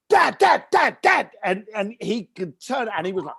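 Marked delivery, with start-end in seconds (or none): 0.1-1.3 high, loud calls of Dad; calling out. Retold or imitated. Excited son calling out to his dad on the site, high and loud. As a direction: shout across the site to get his attention.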